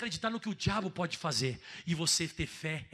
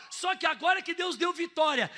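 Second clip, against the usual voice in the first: preaching shout; 11 semitones higher, 3 dB louder than usual. Real, not acted.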